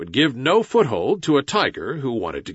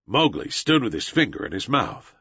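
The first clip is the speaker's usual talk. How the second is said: usual speaking voice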